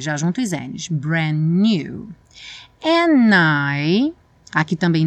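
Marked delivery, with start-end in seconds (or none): 0.9-2.1 "Brand new" with no d, the n's joined; "Brand new" as bran-new. Shown as a demonstration. Teacher models the dropped d: "Brand new" as bran-new. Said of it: "nem pronuncio o D".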